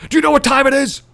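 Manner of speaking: high, loud mock shriek; screaming. Old neighbour flinging her door open on him, high mock scream. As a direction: screech it like an outraged old neighbour. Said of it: "Starts screaming at me".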